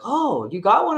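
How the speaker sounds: high, mock-surprised salesman; high mock-surprised snooty clerk. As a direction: toss it off like a snooty clerk, acting shocked. Mocking the rude salesman.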